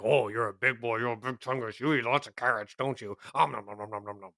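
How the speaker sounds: doting babble in an altered, cutesy voice; pet baby talk. Acted. Tiefling scritching the almiraj's whiskers, doting baby talk. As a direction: coo playfully, trailing into nibbling noises.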